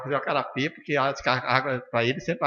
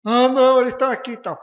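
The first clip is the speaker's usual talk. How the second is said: mock surprise; 11 semitones higher, 9 dB louder than usual. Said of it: "Aí você vê".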